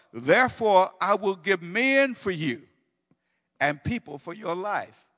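loud, ringing scripture reading; preaching at full force. Acted. Preacher proclaiming God's love to his congregation, loud and ringing. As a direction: proclaim it to the whole church.

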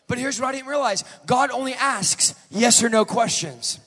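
loud, high, slowing preacher's shout; a sermon climax rising to a roar; full-force preaching. Acted. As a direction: drive it home like a revival preacher. Long altar-call climax.

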